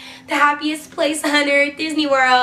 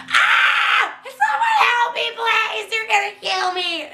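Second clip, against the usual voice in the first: very high-pitched mock scream for help; squealed, hammed-up panic; retold screaming; 10 semitones higher, slower than usual. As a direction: shriek like a trapped victim, acting it out without full volume.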